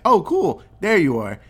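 mock cheerful menace; gleeful taunt. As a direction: greet the attacker before stabbing him. Character finds hidden attacker.

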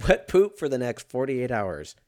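normal talking voice

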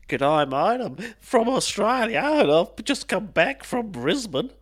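hammed-up Aussie accent, high and slow; mock Australian. Jokingly mimicking Australians, broad Aussie accent. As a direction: play the broad Australian tourist.

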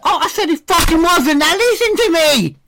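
very high, loud hammy outburst; mock panto rage. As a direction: shriek it like an angry panto dame. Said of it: "That's how pantomimes go".

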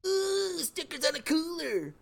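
high, soft delighted coo; dreamy little thrill; mock childlike excitement; giddy. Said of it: "I've just been like".

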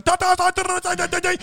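high mock-Russian gibberish; lady ranting in Russian. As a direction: fire back in shrieked fake Russian. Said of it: "And then the lady's like".

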